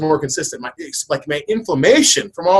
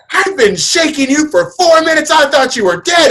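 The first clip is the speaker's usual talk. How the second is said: higher, slow panicked wife; mock frightened wife; 11 semitones higher, 4 dB louder than usual. Wife telling her husband she thought he was dead, mock frightened and high. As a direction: retell her panic, pitched up.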